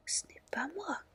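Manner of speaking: put-on horrified girl crying out in a whisper. A narrator's reading. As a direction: gasp it out in whispered alarm. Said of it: "s'écrie-t-elle, horrifiée".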